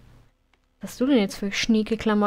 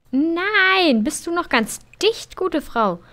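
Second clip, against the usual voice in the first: outraged; 7 semitones higher, 5 dB louder, slower than usual. Real, not acted. Gamer upset at sim eating cake.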